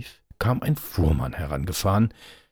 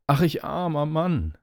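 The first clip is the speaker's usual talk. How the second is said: high-pitched storybook lament; the carter's wailing call, pitch well up; crying out; 9 semitones higher, 4 dB louder than usual. A narrator's reading. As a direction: cry out in acted woe. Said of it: "rief er".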